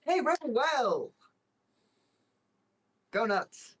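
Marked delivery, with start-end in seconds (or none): 0.0-1.1 high call across the room. Acted. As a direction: call out to them, high and bright. Calling out to a companion.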